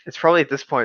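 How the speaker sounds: ordinary delivery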